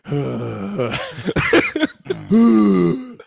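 loud high drawn-out sigh. Shown as a demonstration. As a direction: let out a loud crying sigh. True voice sigh exercise.

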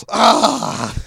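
sudden high loud yelp of pain; hammed-up burn scream; mock pained cry. Worker burned by fryer.

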